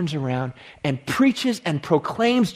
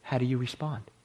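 guided meditation; 3 semitones lower than usual. Acted. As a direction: guide the listeners inward, hushed. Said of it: "lead us in a bit of an exercise".

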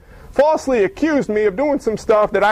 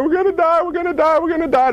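higher, hammed-up panic of the crowd; panicked crying out; 8 semitones higher than usual. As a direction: mimic the frightened people wailing. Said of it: "People are crying".

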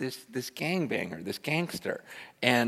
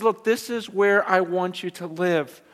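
gentle command; 6 semitones higher, slower than usual. Retold or imitated. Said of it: "he says".

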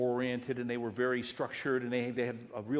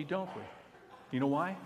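ordinary talk

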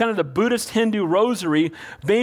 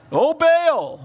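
mock wailing cry; 6 semitones higher, 3 dB louder than usual. Mocking Baal prophets.

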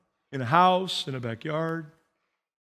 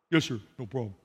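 low, softer groom voice; tough man's man; 10 semitones lower, 6 dB quieter, faster than usual. Retold or imitated. Macho airman answering the pastor before his wedding, low and contained. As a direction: play the cool military guy, pitched way down. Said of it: "we had a man's man".

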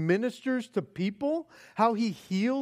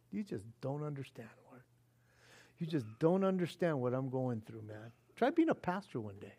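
quiet frustrated grumble; 8 semitones lower, 9 dB quieter, faster than usual. Retold or imitated. Pastor voices his own complaint.

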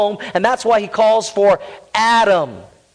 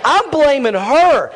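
mock Adam; 6 semitones higher, 3 dB louder, slower than usual. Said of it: "He's like".

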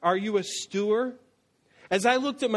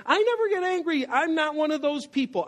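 much higher, squeaky denial; high defensive voice; 8 semitones higher than usual. Shown as a demonstration. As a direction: protest too much in a squeaky voice. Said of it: "squeaky, defensive voice".

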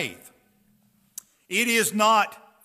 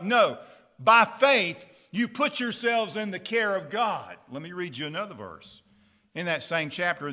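normal talking voice

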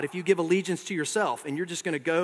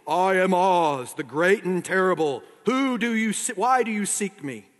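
solemn booming voice; 3 semitones higher, 3 dB louder than usual. Acted. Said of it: "it said solemnly".

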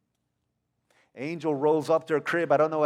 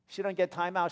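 ordinary talk

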